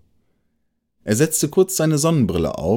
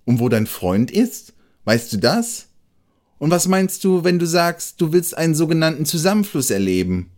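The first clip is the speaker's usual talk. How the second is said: hasty questions; 7 semitones higher than usual. A narrator's reading. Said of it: "Hastig fragte das Eichhörnchen hinterher".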